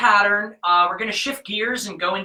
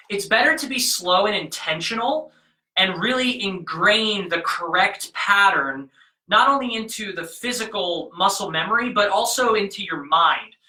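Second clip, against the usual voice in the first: ordinary delivery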